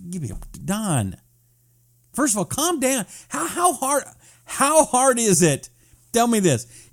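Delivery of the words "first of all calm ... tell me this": exasperated. Real, not acted. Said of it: "exasperated scolding of a viewer".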